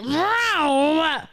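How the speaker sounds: high, drawn-out vroom-like roar; imitated noise of speeding past. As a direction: mimic a driver roaring past. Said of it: "They're just like".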